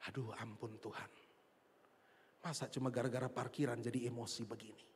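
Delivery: low, hushed plea to God; quietly rueful, pitched well down; chastened confession. Retold or imitated. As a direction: murmur it low, ashamed and repentant. Acts own rebuked, repentant reaction.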